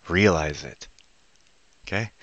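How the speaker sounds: normal talking voice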